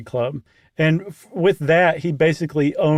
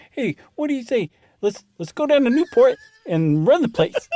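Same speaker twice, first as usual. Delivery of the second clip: high-pitched mock Cleveland accent; 9 semitones higher than usual. Host improvises a mobster planning to take Newport, mock Cleveland accent. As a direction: put on a higher voice for a comic Cleveland accent. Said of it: "That's a Cleveland accent".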